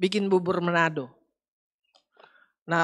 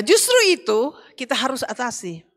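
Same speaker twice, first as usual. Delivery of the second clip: usual speaking voice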